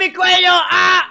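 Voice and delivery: high, loud mock yell of pain; hammed-up cry of pain. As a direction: yelp as if the neck snapped. Bird hurt in game.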